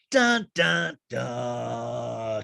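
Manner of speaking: loud dun-dun with a drawn-out finish; climactic mock suspense sting. Recapper capping the table showdown, big mock drama. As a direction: belt out the cartoon cliffhanger sting.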